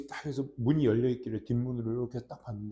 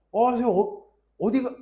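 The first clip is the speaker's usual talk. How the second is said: put-on kids calling out; 9 semitones higher, 6 dB louder than usual. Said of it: "갑자기 애들이 나한테 선생님이래".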